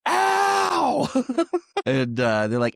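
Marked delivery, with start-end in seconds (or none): 0.1-1.1 very high "ow" shout; hammed-up drawn-out howl of pain; mock yelp of pain. Podcaster imitating the tackled man's cry, high mock yelp.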